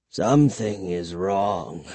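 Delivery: put-on low, slow, soft old vampire; troubled soft vampire. A narrator's reading. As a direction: puzzle over it softly, low and slow. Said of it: "the vampire repeated softly".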